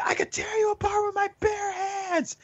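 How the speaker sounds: high, strained mock boss rant; angry boss quote. Retelling the underboss threatening the worker, high mock rant. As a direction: squeal it like a furious underboss. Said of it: "he's a little upset".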